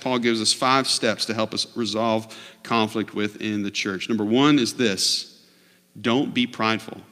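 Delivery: ordinary talk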